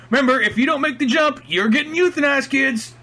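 put-on high, loud announcement to kids; imitating a coach. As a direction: call out the threat like a peppy coach. Playing the coach warning kids.

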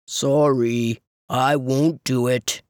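low, deep lazy voice; deep camel voice. Acted. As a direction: drop deep like a big camel. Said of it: "in his deep voice".

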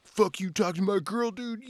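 high-pitched, imitated jealous outburst; fired up. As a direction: act out a riled-up boyfriend picking a fight. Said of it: "he gets all fired up".

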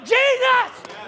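high-pitched shouted cry. Acted. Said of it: "He cried out".

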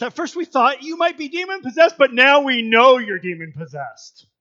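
the crowd accusing Jesus, heated; exclaimed accusation. Acted. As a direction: read it as an angry crowd. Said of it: "they exclaimed".

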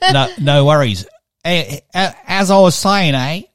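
loud, high Australian impression; hammed-up Aussie accent with a questioning "eh". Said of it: "Australian accent".

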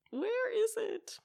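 high, drawn-out retold question; slow, high-pitched mock bewilderment; mock searching call.

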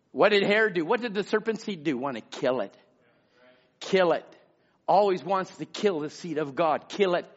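ordinary delivery

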